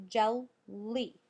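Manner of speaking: Jelly as two separate beats, jel, lee; Jelly, syllable by syllable; clapped-out syllables. Shown as a demonstration. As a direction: split Jelly clearly after jel. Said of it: "when you clap it out".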